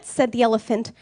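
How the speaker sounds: usual speaking voice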